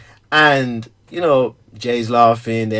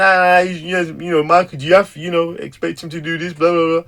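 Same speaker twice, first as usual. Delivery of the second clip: mocking the women; 4 semitones higher than usual. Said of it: "these kind of truthful statements".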